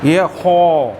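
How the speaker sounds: خو said with a full, rasping throat kh; full-mouth kha. Shown as a demonstration. Teacher modelling the heavy خ sound. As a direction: fill the mouth and scrape out خو. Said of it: "منہ بھر کے".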